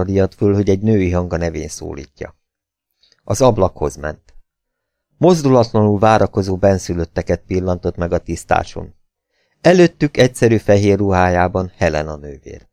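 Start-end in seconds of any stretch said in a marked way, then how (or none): none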